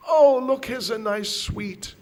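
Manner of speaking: high, loud start, easing off; coaxing a baby. Acted. Ladies offering crying child a sweet, eagerly coaxing. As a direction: tempt a crying child with a treat. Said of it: "they tried all their tricks".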